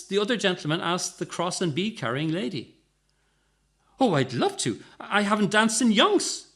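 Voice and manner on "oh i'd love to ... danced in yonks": rising, louder delight; enthusiastic. A narrator's reading. Cross lady accepting a dance, enthusiastic. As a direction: gush with delight. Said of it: "she replied enthusiastically".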